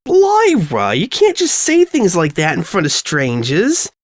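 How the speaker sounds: put-on high, exasperated girl's voice; scolding friend. Friend scolding Lyra in front of strangers, high exasperated voice.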